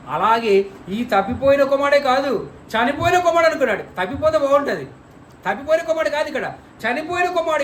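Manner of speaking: rising preacher's passion; fervent preaching. Real, not acted. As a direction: drive the point home with fervor. Preacher's emphatic climax.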